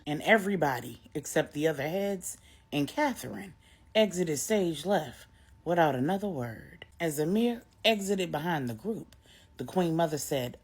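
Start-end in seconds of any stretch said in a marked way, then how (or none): none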